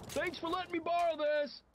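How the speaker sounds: imagined scream. Retold or imitated.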